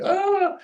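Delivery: a short, very high mock scream "Ah"; retold high scream. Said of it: "the woman screamed".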